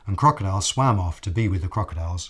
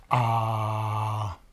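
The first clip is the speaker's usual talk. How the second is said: open-mouthed ah. Acted. Crocodile opening mouth wide.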